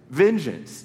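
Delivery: ordinary talk, nothing marked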